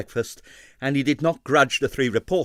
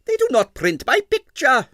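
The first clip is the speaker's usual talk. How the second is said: high, louder peevish complaint; 6 dB louder than usual. A narrator's reading. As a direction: whine it like a spoilt man. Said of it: "he said peevishly".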